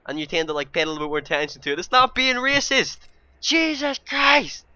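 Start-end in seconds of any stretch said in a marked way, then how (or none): 1.8-4.7 high, loud, drawn-out protest; carried-away exasperation. Real, not acted. Vlogger defending himself against racism claims, exasperated and carried away. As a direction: cry out in real exasperation. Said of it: "sorry, I'm getting carried away again".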